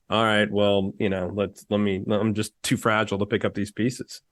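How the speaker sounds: crushed ego guy. Retold or imitated. Friend mocking rejected guys who sulk off, low deflated voice. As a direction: play a guy whose ego just got pummeled. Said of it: "they'd be like".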